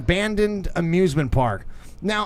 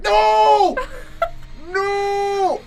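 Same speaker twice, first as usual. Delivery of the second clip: put-on much higher, louder panicked child's cry; scared kid yelling no; 4 dB louder than usual. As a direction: shriek it like a frightened little kid. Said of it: "I'd be like".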